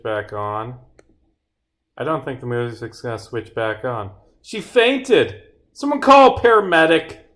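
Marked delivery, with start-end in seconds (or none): 4.4-7.2 loud, high, urgent shout; theatrical emergency yell; alarmed cry for help. Acted. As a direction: call out in acted panic. Acted alarm, calling for help.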